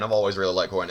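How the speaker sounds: ordinary delivery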